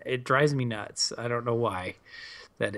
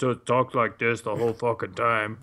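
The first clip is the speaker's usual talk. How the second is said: lower, put-on tough soldier voice, only lightly done; a hint of a stern space marine growl in a lower register; mock Master Chief voice; 4 semitones lower than usual. Mocking Master Chief for podcast friends, lower put-on soldier voice. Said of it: "can you imagine Master Chief just".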